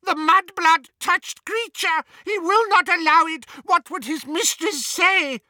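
very high, loud, outraged elf voice; repulsed house-elf. A narrator's reading. Elf recoiling from Hermione's hug, repulsed shriek. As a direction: shriek it in revulsion. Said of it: "quite obviously repulsed".

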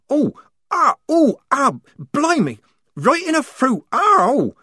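high, loud hammed-up wailing; hamming up a painful groan; fake lamenting. Polly fakes a painful wail for the lieutenant, high and loud. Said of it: "she lamented".

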